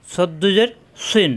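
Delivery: সদ্দুজের সিন drawn out slowly, syllable by syllable; slow recitation. Shown as a demonstration. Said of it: "তানবিন".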